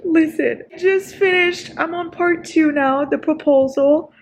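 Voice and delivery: high, crying, drawn-out words; tearful joy. Real, not acted. Reader reporting back after the proposal, tearfully happy. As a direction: wail it, overcome with happy emotion. Overwhelmed after finishing the book.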